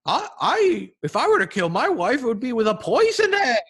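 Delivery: hoher, heller Hasen-Piepston; Easter Bunny voice. Acted. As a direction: quiek es wie ein aufgeregter Osterhase. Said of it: "I like your Easter Bunny voice".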